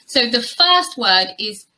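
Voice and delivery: ordinary delivery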